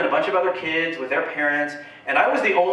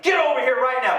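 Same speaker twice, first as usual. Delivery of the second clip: mock yell; 8 semitones higher than usual. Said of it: "I yell at her sister".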